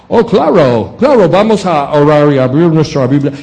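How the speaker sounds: sarcastic impression. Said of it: "o hasta hacer impresiones".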